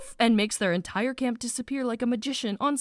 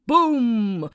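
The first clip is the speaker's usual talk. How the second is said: louder, bigger boom effect; 5 dB louder than usual. Retold or imitated. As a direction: punch the boom out. Imitating explosions.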